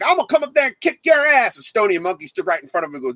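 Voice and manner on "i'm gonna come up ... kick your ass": high, mock menacing regular; threatening drunk. DJ imitating regular's threat, high mock menace. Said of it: "He's like".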